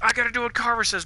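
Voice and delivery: lightly mocking a wishy-washy follower; mock Bonnie. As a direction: rattle off Bonnie's excuse in a put-on voice. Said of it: "It's like".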